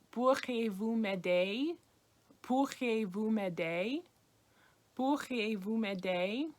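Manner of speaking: Pourriez-vous m'aider, slow with a rise at the end; slow French request. Shown as a demonstration. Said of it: "similar to the".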